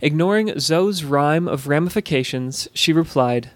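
plain reading voice

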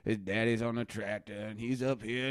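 ordinary talk

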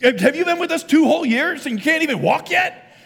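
high, loud, exasperated scolding; hammed-up angry dad shouting at a toddler; mock scolding father. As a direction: berate a toddler in mock outrage. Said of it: "I don't pick him up and say".

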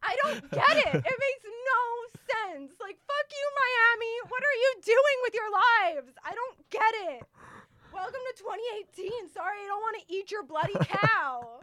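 loud, high-pitched exasperated rant; mad rant. Real, not acted. Ranting to her co-host about Miami, loud and furious. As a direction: vent at the whole city, fired up. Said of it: "It gets me so mad".

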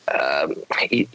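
usual speaking voice

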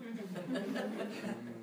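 soft, sly serpent chuckle; a quiet, wicked little laugh; sinister laugh. Acted. Serpent laughing softly at the woman.

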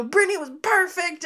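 high, aggrieved mock complaint; imitating an indignant sister; vindicated sister. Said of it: "so vindicated".

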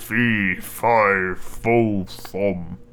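low, slow booming giant; giant's voice. Acted. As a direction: rumble it out like a menacing giant. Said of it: "The giant cried".

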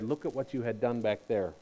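low, softening to a quiet calm; a gentle divine rebuke fading to hush; lightly voiced God, quiet and calm. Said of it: "it really was God who said".

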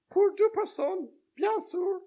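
reply like a polite French landlady; high, obliging French madame; French lady. Retold or imitated. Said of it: "The French lady replied in French".

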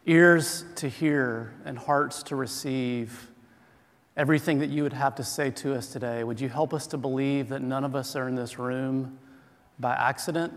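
low, soft congregational prayer; hushed, reverent petition; opening prayer. Real, not acted. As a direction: pray quietly and evenly on everyone's behalf.

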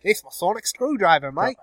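hammed-up Australian with a matey lilt; mock Australian accent. Australian Doctor showing off the screwdriver, mock Australian accent. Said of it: "I'd be Australian".

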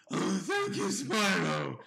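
loud, hammed-up lady played by a guy; comic drag damsel, pushed up high; Juliet with a guy's voice. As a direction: gush loudly in a man's mock-feminine voice. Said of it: "a guy's voice".

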